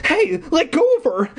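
put-on high boyish cry; cried out. A narrator's reading. Eddie cries out to the caped man. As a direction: yell at the grabber. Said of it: "Eddie cried".

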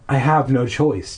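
ordinary delivery, nothing marked

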